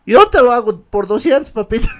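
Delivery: high coaxing mock flirt; flirty offer.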